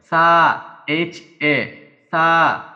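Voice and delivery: সা called out loud, then spelled H A; loud kana demo. Shown as a demonstration. Teacher drilling সা for learners, loud and clear. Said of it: "spells out sa for students".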